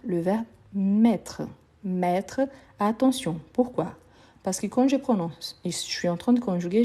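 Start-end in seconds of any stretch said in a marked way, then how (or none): none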